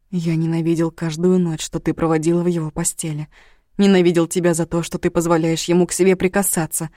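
plain reading voice

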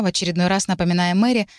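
plain reading voice

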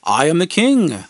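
loud, grand mock declaration; asserting. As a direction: proclaim yourself king, hammed-up. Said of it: "I asserted".

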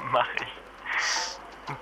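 soft breathy chuckle into reply; soft laugh. Acted. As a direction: laugh softly and answer. Lacht gerührt leise. (leises Lachen)

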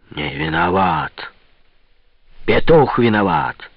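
drawn-out, wheezed mockery of the excuse; hoarse rasp. A narrator's reading. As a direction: rasp it slowly at your son. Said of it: "прохрипел Пётр Данилович". (хриплый протяжный голос отца)

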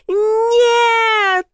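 long, high-pitched wail of refusal; desperate scream. A narrator's reading. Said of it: "заорала я".

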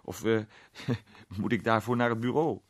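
hesitate, chuckle, then ask it amused; chuckling. A narrator's reading. Arthur asking whether he must go to the station, chuckling. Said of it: "zei Arthur grinnikend".

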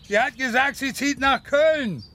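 loud slow insistence; insistent. Acted. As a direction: drive the news home to him, slowly. Father hammers home the news.

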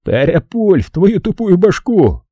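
slow, high-pitched threat in a put-on voice; angry threat. A narrator's reading. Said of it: "прошипел Кирк". (злобная угроза, высоким голосом)

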